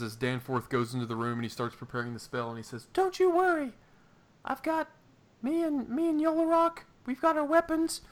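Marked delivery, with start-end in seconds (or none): 2.9-8.0 very high squeaky voice; way up high, eager guard; Benry voice. Acted.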